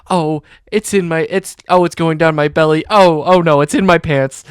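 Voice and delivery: loud, flustered retold panic; mock panic. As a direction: blurt it out like a spill is running down you. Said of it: "she goes".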